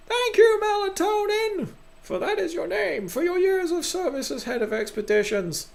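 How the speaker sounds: very high, theatrical proclamation; pompous council head. Acted. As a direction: squeak out the ceremonial thanks grandly.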